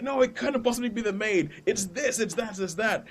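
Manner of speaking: high, insistent sheep voice waving the idea off; know-it-all sheep. Retold or imitated. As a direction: voice the smart sheep sure it knows best. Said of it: "the other one is like".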